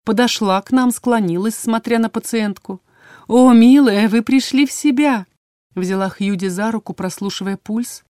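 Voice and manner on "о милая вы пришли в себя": louder, higher gushing exclamation; delighted healer. A narrator's reading. Healer greets waking patient, delighted gushing. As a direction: coo with delight over the patient.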